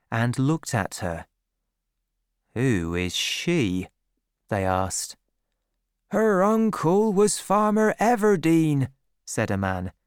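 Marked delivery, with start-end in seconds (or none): 2.5-3.9 low, slow male voice; low curious murmur of country men; farmers. A narrator's reading. Farmers asking about the stranger. 6.1-8.9 higher put-on country man's voice; high-pitched market farmer; farmer gossiping. A narrator's reading. A market man explains, voiced.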